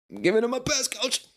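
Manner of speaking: earnest player answering his coach; eager athlete to coach. Pretend. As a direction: chirp it back to the coach like a keen rookie.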